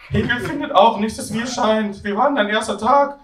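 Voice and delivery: high-pitched flatmate voice. Acted. Flatmate disagreeing with the others, high character voice. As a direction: lift the voice far above your own.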